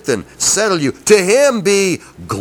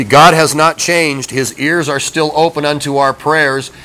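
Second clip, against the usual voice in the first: usual speaking voice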